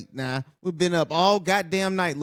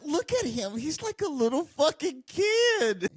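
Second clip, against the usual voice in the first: high, squeaky mocking voice of a giddy kid. Vlogger mocking the champion as a giddy kid. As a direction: mimic him like an excited little kid.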